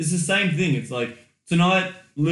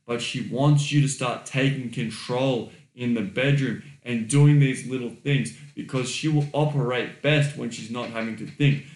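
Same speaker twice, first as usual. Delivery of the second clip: usual speaking voice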